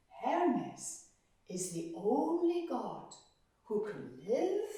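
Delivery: ordinary delivery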